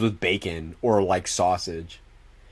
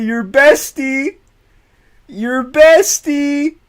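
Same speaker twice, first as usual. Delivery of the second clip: much higher, slow, drawn-out mocking lilt; teasing singsong; 8 dB louder, much slower than usual. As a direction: stretch it high and slow to tease. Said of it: "I was gonna say".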